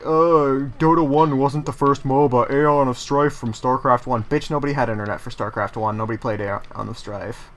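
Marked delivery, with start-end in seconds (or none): 0.0-4.2 louder, snooty correcting voice put on; mock know-it-all commenter. A comment correcting the streamer, snooty mock know-it-all. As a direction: play a smug commenter correcting you. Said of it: "some asshole in the comments gonna be like".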